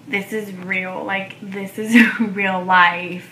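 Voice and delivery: loud, slow, laughing in disbelief; overjoyed disbelief. Retold or imitated. Telling viewers she's pregnant, loud laughing joy. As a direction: let the joy spill out into a laugh.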